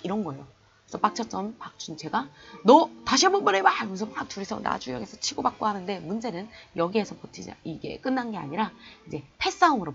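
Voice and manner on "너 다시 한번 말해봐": much higher, louder angry shout; furious challenge. Retold or imitated. Cousin challenges Fukuda to repeat insult, furious shout. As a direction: dare him to say it again.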